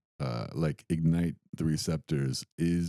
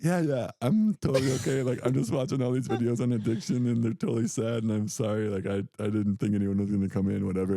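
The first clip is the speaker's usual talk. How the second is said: higher, embarrassed rush of excuses; flustered reassurance; 6 semitones higher than usual. Retold or imitated. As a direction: cover up the crying, flustered. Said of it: "And I'm like".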